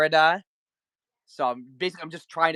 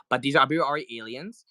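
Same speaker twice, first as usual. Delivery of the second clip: usual speaking voice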